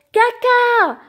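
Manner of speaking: put-on high, loud child's yell. A narrator's reading. Child yelling to wake her sleeping parents, high and loud. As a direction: shriek it to wake the whole house. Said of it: "je hurle".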